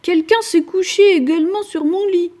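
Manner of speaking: mama bear, indignant; maman ours. A narrator's reading. (voix de maman ours, plus aiguë)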